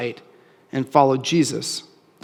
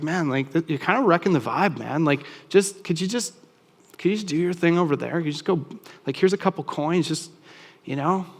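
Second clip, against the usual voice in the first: softer, lazy brush-off, half-mocking; dismissive crowd; 5 dB quieter than usual. Well-off crowd waving off blind beggar, softer dismissive brush-off. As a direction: shoo him away like he's killing the mood.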